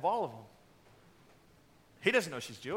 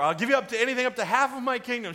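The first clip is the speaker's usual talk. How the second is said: loud, high kingly proclamation; hammed-up magnanimous offer; mock royal offer; 7 semitones higher, 8 dB louder, faster than usual. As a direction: imitate a grandly generous king. Replays king's grand offer to wife.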